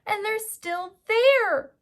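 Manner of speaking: very high cub voice rising to a loud cry; baby bear discovering the sleeping girl. Acted. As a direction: squeal it like a startled little cub. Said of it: "he cried".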